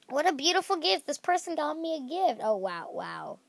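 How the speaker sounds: ordinary talk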